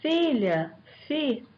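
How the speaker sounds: normal talking voice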